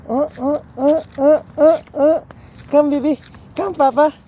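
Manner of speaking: high, eager calls. Real, not acted. Coaxing toddler to walk to parent.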